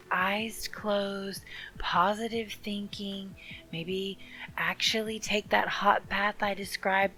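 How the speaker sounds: soft, whispery meditation voice; hushed, calming breathwork guide; guided meditation. Acted.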